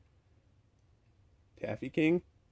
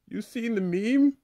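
a high cartoon kid, mildly panicked; high squeaky character; 10 semitones higher than usual. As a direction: squeak it out, scared of the mob. Laffy Taffy asks.

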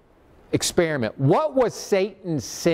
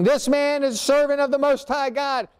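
pitched up high, a mild mock yell rather than a real shout; retold yelling; 7 semitones higher than usual. Possessed girl trailing Paul, mild mock yell. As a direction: call it out after him like the possessed girl, lightly.

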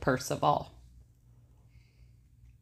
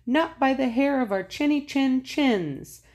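higher squeaky piglet voice; little pig voice; 9 semitones higher than usual. Acted. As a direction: chirp it like a small piglet. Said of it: "the little pigs replied".

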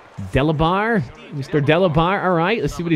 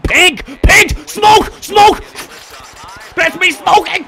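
much higher, loud hype chant; loud cheering for his slugger; excited hype shout; 5 dB louder than usual. Real, not acted. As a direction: hype up the batter at the top of your voice. Hyping his batter Smoke.

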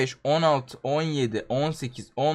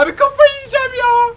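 high, loud, desperate cry; frustrated wail; 6 dB louder than usual. Real, not acted. Gamer facing impossible boss, high frustrated wail. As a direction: cry out like you're going mad.

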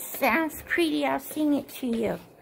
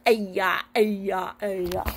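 low mock crying sounds; mock whimper; 6 semitones lower, much slower than usual. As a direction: pretend sobbing. Toy sobbing.

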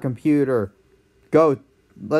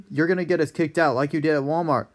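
normal talking voice

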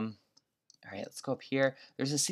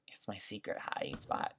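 whisper; 3 semitones lower, 12 dB quieter than usual. Real, not acted. Hiding, whispering to viewers.

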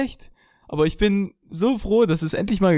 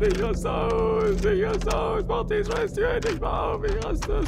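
screaming in frustration; 14 semitones higher, 4 dB quieter than usual. Real, not acted. Died again, screams at door.

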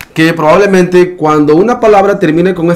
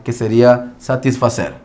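ordinary talk

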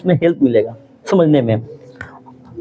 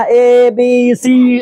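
slow, drawn-out parrot chant; mock a kid reciting by rote; rote recital like a child; 4 dB louder, much slower than usual. Shown as a demonstration. Teacher mocks rote learning with a slow childish chant. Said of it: "बच्चा की तरह".

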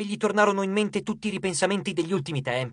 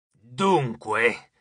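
low, drawn-out Cupid, intense; god's voice, deep and ominous; deep menacing god; 8 semitones lower than usual. A narrator's reading. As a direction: intone it slowly and low, with menace. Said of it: "Era profonda e intensa".

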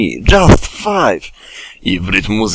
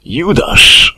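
growled; 10 semitones higher, 7 dB louder than usual. Acted. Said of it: "warknął Tygrysek".

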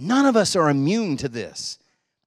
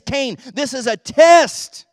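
calling out; 7 semitones higher, 10 dB louder, slower than usual. Retold or imitated. God calls out to Cain.

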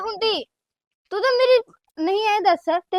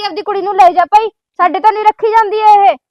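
put-on louder, scolding threat to the girl's mother; angry threat; 7 dB louder than usual. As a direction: bark it like you're on the phone to her mother. Sister rehearses an angry phone threat.

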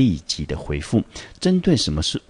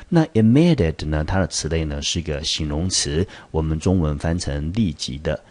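ordinary delivery

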